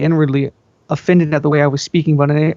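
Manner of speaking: plain reading voice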